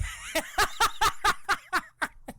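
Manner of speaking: very high pulsing fake sobs; comic high-pitched blubbering; staccato mock bawling. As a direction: burst into rapid, hammed-up wailing sobs. Acted grief, bawling without words.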